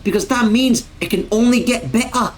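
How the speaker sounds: hammed-up Scottish congratulations; Scottish accent. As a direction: quote it as a Scottish streamer. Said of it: "can't say this quote in the full accent".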